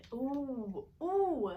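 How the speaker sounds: whispered ooh bursting into a loud high ooh; pained ooh. Real, not acted. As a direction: gasp at the heat. Reacting to spicy bite.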